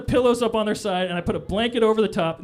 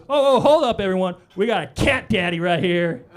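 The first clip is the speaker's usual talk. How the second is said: mock security guard shout; 7 dB louder than usual. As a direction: call it out like a proud guard. Security guard calls out to crowd.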